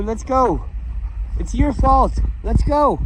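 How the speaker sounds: exasperated owner yelling at his dog to move. Real, not acted. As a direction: snap at the dog to hurry. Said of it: "'cause I'm yelling at you".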